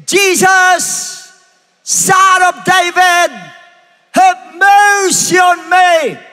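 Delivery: long, high, drawn-out shout; crying out. Acted. Blind Bartimeus calling to Jesus for mercy, crying out. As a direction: shout it slowly, stretching every word. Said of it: "He began to cry out and say".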